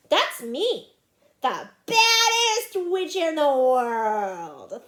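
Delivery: loud, drawn-out witchy gloating; proud boast. Acted. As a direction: relish being the bad one. Ramona proudly thinking her boast.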